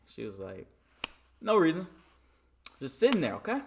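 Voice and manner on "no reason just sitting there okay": higher, louder brush-off as mom; loud, offhand mom retort; mock dismissive mom. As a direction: imitate mom shutting the question down. Mom brushing off his question.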